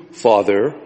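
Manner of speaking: ordinary talk, nothing marked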